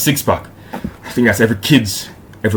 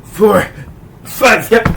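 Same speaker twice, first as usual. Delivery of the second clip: strained high grunted counting; doing push ups; 11 semitones higher, much slower than usual. Real, not acted. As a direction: force out the last reps. Last reps of the set.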